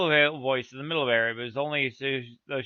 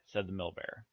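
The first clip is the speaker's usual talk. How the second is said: ordinary delivery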